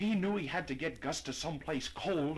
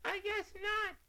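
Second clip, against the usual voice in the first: weak tiny voice. A narrator's reading. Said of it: "Gus answered weakly".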